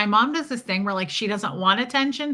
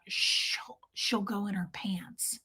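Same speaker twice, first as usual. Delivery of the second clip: unvoiced mock whisper; imitating mom's hushed aside; 9 dB quieter, slower than usual. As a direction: whisper the embarrassing part. Mom's aside about gallbladder.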